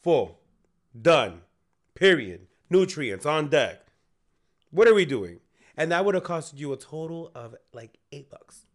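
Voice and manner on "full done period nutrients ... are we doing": hyped up. Retold or imitated. Hyped retold advice.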